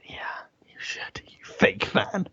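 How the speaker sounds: slow whispered jibe; mock accusing whisper. Host needling co-host for not knowing Brooklyn, mock whisper.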